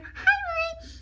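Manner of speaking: put-on very high, light girl voice; a sweet little girl saying hi. Little girl greets brother.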